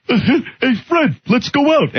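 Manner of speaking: a bad replacement voice actor; fake Barney voice. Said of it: "Barney would just be like".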